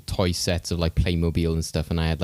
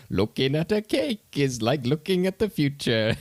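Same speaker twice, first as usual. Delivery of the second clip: higher, slow, dreamy mock-profound line; quoting a film line; 8 semitones higher than usual. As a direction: hold each word like a spaced-out oracle, pitched up. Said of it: "And he's just there like".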